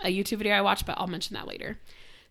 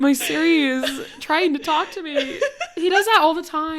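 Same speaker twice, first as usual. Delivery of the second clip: loud, higher, through laughter; laughing explanation; 6 semitones higher, 4 dB louder than usual. Real, not acted. Host explaining the phone assistant's interruption, laughing. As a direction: explain it while cracking up.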